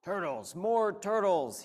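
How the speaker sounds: loud, high, slow bellow; sudden loud outburst. Acted. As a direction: bray it out loud. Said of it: "he bellowed and brayed".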